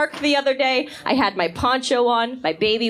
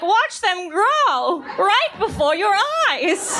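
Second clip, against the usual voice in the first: slow, higher infomercial pitch; mock TV ad voice; ad announcer; 7 semitones higher, slower than usual. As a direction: sell it like a chia pet commercial. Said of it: "They're just like".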